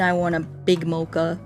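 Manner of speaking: ordinary delivery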